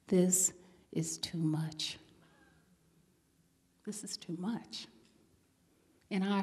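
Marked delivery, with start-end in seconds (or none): 0.1-4.9 soft, low whisper to herself; quiet self-talk. Retold or imitated. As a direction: hush it like a private realization. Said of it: "I said to myself".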